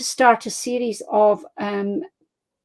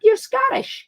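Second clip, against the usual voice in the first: excited mock gush; 13 semitones higher than usual. Americans react to Highland dress.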